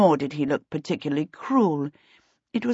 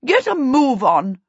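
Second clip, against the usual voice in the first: high, loud burst of impatience; angry; 7 semitones higher, 6 dB louder than usual. A narrator's reading. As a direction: order the laggards along crossly. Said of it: "he said angrily".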